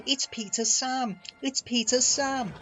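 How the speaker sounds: high, pleading cry; wailing. A narrator's reading. Empty trucks warning it is the wrong engine, wailing. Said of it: "wailed the empty trucks".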